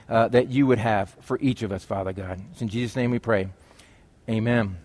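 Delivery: low, subdued praying voice; prayer. Real, not acted. Pastor praying to God before reading the verse, hushed prayer.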